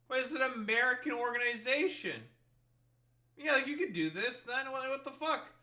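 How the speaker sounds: can't believe what you just read; exasperated disbelief rising up; incredulous. Real, not acted. Realizes the group is American.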